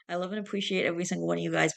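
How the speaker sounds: fast, run-together sign-off; rattled-off outro. Real, not acted. Repeating a half-forgotten outro to viewers, rattled off fast. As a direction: race through it like a memorized line.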